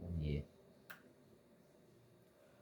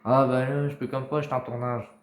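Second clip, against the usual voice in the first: shouting to someone in another room; calling out; 3 semitones higher, 10 dB louder than usual. Real, not acted. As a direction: call it out loud across the house.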